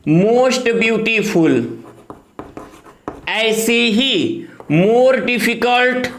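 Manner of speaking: very slow, drawn-out words for students to write down; slow dictation. Shown as a demonstration. Teacher dictating comparative forms to students, very slow. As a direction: stretch each word so the class can copy it. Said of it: "अब ये चीज आपको याद रहे".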